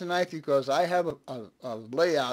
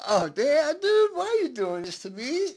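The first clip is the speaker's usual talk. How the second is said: mock exasperation; 10 semitones higher, 4 dB louder than usual. Real, not acted. Viewer teasing him on stream.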